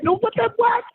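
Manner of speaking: squeaky high disbelief; mock high squeak. Mocking someone on the phone, high squeak. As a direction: pitch way up in mock confusion. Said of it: "I'm like".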